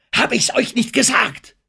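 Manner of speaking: triumphierend, sehr hoch und laut; juble, dass du recht hattest; jubelnd. A narrator's reading. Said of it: "rief Denham jubelnd". (jubelnder Triumph)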